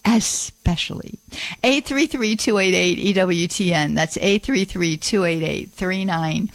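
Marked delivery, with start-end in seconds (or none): 0.0-1.2 "especially" with a long S and a break after it; correct "especially", no X. Shown as a demonstration. Host advising future callers, "especially" with exaggerated S. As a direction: draw out "ess", then finish "especially". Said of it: "just know it's".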